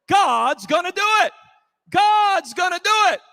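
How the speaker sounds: loud, high joyful shout, as if singing out; shouting in faith. Retold or imitated. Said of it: "shouting and singing even louder".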